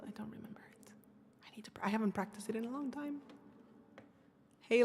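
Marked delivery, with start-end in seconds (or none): 0.1-3.2 hushed whisper to herself, barely voiced. Real, not acted. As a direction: breathe the words out as a worried aside. Forgetting lyrics, muttering to self.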